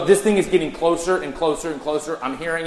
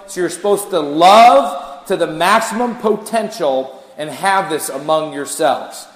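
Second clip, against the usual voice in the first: slow and louder, stretching each word; slow emphasis; 3 semitones higher, 7 dB louder, slower than usual. Real, not acted. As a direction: lean into maximum potential. Emphasising maximum love.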